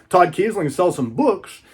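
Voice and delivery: normal talking voice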